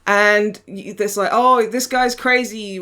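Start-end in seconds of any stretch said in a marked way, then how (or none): 1.3-2.8 slightly slow, louder hammed-up Russian villager; lightly put-on Russian accent; a hint of bad Russian accent; mock Russian accent. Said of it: "in their terrible Russian accents".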